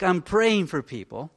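ordinary talk

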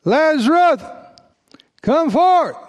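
high, commanding call with a pause midway; loud cry. Acted. Preacher as Jesus calling Lazarus from the tomb, loud cry. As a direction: call out high, as if summoning the dead. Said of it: "he cried out with a loud voice".

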